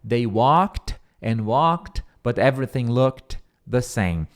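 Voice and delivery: very slow model sentence for students; slowly, word by word, so they can repeat; slow repeat-after-me. Shown as a demonstration. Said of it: "se você repetir comigo".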